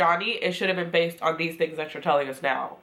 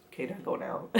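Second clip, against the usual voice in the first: mock bored whisper; 10 dB quieter than usual. Dismissive quote, unvoiced.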